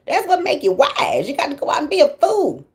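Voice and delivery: ordinary delivery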